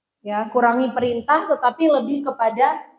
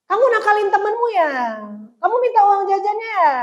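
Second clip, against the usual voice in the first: accusing; 7 semitones higher, faster than usual. Retold or imitated. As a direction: snap out blame like a suspicious mother.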